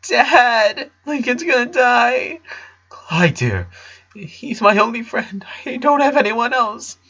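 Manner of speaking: put-on high, tearful boy's voice; a crying son clinging on; mourning. A narrator's reading. The son cries to his dad about losing his friend. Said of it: "Clyde was mourning too".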